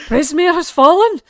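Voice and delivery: high, loud gasp of disbelief; stunned disbelief. Acted. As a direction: she can't believe her kingdom fell. GM voices stunned fairy godmother.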